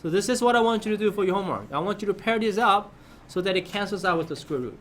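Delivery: ordinary delivery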